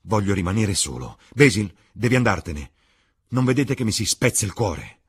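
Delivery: desperate youth begging to be left; anguished outcry. Acted. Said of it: "gridò il ragazzo". (Dorian implora gli amici, con voce più forte e straziata)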